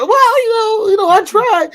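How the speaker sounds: imitating friend's high whiny voice; whiny excuse. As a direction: squeak out a feeble excuse. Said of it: "he sound like".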